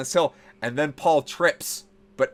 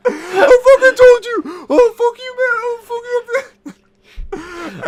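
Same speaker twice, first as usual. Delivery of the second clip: yelling through laughter; 11 dB louder, slower than usual. Retold or imitated. Retelling the yell while laughing.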